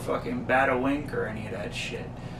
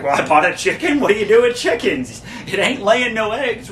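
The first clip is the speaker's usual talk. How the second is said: louder, lightly voiced dim Kramer; mimicking Kramer; 7 semitones higher, 7 dB louder than usual. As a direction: play the clueless guy with a chicken. Kramer voiced in retold scene.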